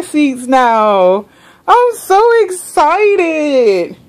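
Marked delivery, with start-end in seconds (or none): 1.7-4.0 very high, slow gushing squeal; high drawn-out excited squeal; squealing excitement. Real, not acted. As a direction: squeal it out with glee.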